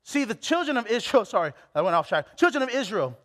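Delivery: ordinary talk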